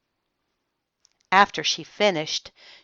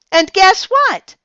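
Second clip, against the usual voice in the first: put-on high, loud triumphant child; child proudly ending her story; proud and perky; 13 semitones higher, 8 dB louder than usual. A narrator's reading. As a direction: boast that nothing broke.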